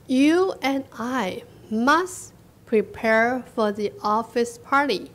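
very slow, word by word; spaced-out teacher reading for learners; slow read-aloud. Shown as a demonstration. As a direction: model the line slowly with long gaps. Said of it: "我们慢慢读".

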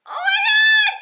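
piercing high scream of pure joy; excited scream. Real, not acted. Opening a package she's obsessed with, real excited scream. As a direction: shriek it out, thrilled to bits. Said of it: "Sorry, I'm so excited".

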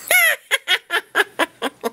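squeaky high giggle. Pretend. Vlogger giggles in a high squeak. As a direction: let out a tiny high laugh.

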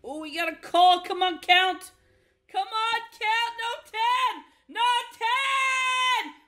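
high, loud pleading at the cards; excited yelling. Real, not acted. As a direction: scream at the river to miss. Begging for the river card.

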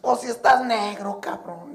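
high, slow, loud taunt; mocking paisano. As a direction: drag it out to needle him. Paisano mocks.

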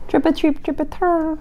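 high, trilling birdsong; blackbird trill. Acted. Voicing a singing blackbird, high and trilled.

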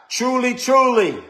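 TRULY, TRULY pitched high, each one punched; emphatic repetition. Shown as a demonstration. Preacher showing why Jesus doubles the word, emphatic. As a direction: ring out "Truly, truly" high, with weight. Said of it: "To emphasize".

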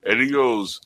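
ordinary delivery

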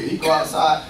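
ordinary delivery

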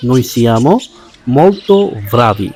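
very slow, each word laid out clearly; slow teacher demo; slow model reading. Shown as a demonstration. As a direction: spell the sentence out slowly for learners. Teacher models Italian sentence.

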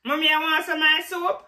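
loud, high call to mom. Real, not acted. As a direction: shout an offer across to mom. Calling to mother with an offer.

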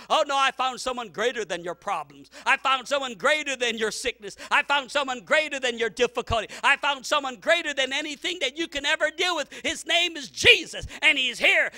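loud, ringing testimony; full force preaching. Acted. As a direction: preach each line harder.

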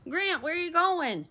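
high-pitched call to a pet; calling the dog. Real, not acted. Owner to dog wandering off, high pet talk. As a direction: lift the pitch right up, questioning the dog.

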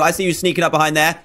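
normal talking voice

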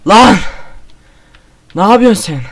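high loud panicked yells; real fright, screaming; terrified screaming. Real, not acted. Monster appears suddenly.

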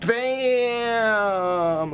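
loud, high exclamation; excited reveal. Real, not acted. Revealing a bag of energy drinks to viewers, loud and high. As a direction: announce it with a burst, pitched well up.

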